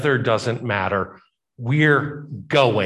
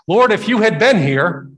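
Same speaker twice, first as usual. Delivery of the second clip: reproachful grief; 9 semitones higher, 6 dB louder than usual. Acted. Grieving sister reproaches Jesus.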